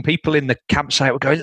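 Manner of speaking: ordinary delivery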